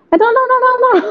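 a flustered mother calling for help in a hurry; imitating a panicking mum rushing her kid; panicky mom. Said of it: "emak-emak lagi panik kan, buru-buru".

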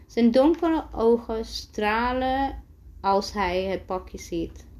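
usual speaking voice

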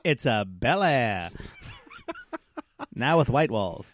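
mock fifties guy's pitch, with laughs; a hammed-up fifties guy asking a girl out; fifties greaser. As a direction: ask her out like a cocky fifties teen.

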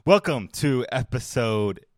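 hyped intro. Acted. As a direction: announce the episode like a big hype host. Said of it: "you weren't equally hyped".

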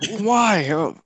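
put-on high-pitched kid demanding answers; imitated insistent kid. Kid questioning the camp counselor, imitated high insistent voice. Kids protest in imagined exchange.

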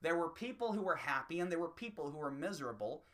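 ordinary talk

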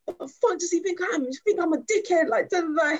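high-pitched mock grumbling to himself; indignant muttering. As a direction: imitate a wounded man complaining to himself. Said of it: "just muttering to himself".

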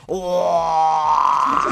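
a loud mock wail of grief, long and held; wailing cry. A narrator's reading. Narrator mimicking Meng Jiangnü wailing at the wall, a loud held cry. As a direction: throw out one big wailing cry. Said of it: "就跟天上打雷一样啊".

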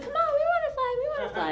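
high, squeaky pleading; high whiny penguin plea, laughing; begging penguins. Pretend. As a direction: whine it like a little penguin.

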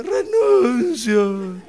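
very slow, drawn-out wailed vow; mock tearful surrender. Groom finally renouncing after crying, mock tearful wail. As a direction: give in through a fake sob. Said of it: "Después de llorar media hora dice".

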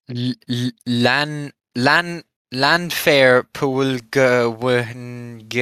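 very slow, syllable-by-syllable Welsh place name; slow attempt. Shown as a demonstration. Trying to pronounce the long Welsh town name, very slowly. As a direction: sound out Lanfair Pwlgwynge piece by piece. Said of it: "I tried to pronounce".

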